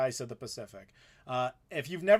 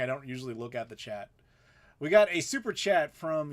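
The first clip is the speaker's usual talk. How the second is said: normal talking voice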